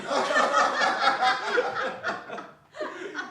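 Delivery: loud, high roaring laugh. A narrator's reading. Friends at the party roar with laughter together. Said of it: "roared out lustily".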